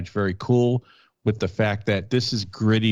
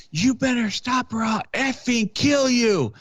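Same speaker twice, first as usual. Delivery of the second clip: retold shout; 12 semitones higher, slower than usual. Cop yelling at a fleeing suspect through the fence, high mock shout. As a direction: bark the threat at him, high and drawn out. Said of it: "I shouted at the guy".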